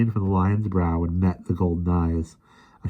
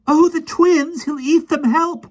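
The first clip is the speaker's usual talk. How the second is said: high, loud panicked cry for help; frightened cry; 8 dB louder, slower than usual. A narrator's reading. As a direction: shriek for help in terror. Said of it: "cried Jane".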